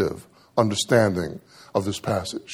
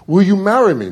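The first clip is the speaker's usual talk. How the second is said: louder, higher mock plea; mock pleading, louder and higher; pleading proposal; 8 semitones higher, 6 dB louder than usual. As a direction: ham up the begging, raised. Acted proposal, repeated pleading.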